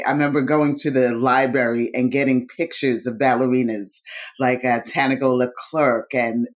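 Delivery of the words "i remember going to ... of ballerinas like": ordinary talk, nothing marked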